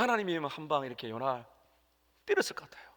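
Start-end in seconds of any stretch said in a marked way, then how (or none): 2.3-2.9 high whispered joke; playful whisper. Real, not acted. Pastor whispering a joke to the congregation about hitting Jonah. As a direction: whisper it like a naughty confession.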